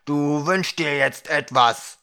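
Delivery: loud, slow, insistent command; menacing order. A narrator's reading. Ukra lifting the dwarf and ordering a wish, loud and slow. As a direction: lean hard on each word, slowly.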